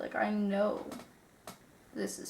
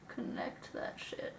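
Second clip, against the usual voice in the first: whisper; 12 dB quieter, slower than usual. Real, not acted. Concentrating on eyeliner.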